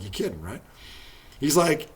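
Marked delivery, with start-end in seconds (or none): 0.0-0.6 smirking quote. Retold or imitated. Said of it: "McHale just kinda smirked".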